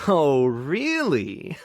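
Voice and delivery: very slow, drawn-out teasing doubt; mock disbelief. Owner teasing a mischievous pet, drawn-out mock disbelief. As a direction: stretch it out, playfully unconvinced.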